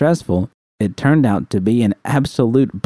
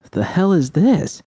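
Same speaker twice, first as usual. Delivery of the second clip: puzzled surprise; 9 semitones higher than usual. Acted. V finds old keyboard.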